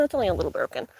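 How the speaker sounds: ordinary talk, nothing marked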